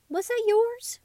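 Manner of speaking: high, squeaky question. Acted. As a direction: ask it with a high little squeak. Dog asks surprised.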